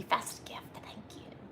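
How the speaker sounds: soft whispered gratitude; real whispered thanks to a viewer for a gift. Real, not acted. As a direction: say it under your breath, almost private. Thanking a viewer under breath.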